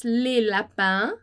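slow, carefully spaced French; slow model reading. Shown as a demonstration. Teacher reading slowly to class, slow and clear. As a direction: read it out slowly for students. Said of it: "Então, mais uma vez".

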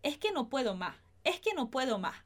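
es que no puedo más with s breathed as h; aspirated s. Shown as a demonstration. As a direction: say eh ke no podo ma. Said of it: "Essa é um pouquinho mais fácil".

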